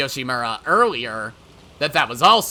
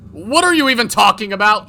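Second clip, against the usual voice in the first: loud, high protest; exasperated anger; 9 semitones higher, 12 dB louder than usual. Acted. Yoshimura denying the accusation, exasperated. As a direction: throw the question back angrily.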